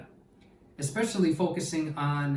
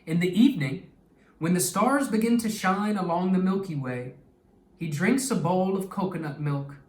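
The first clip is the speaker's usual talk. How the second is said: expressive read-aloud storytelling voice; story narration; 4 dB louder than usual. Acted. Teacher reading a story to her class, lively and expressive. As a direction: read it like a picture book to young kids.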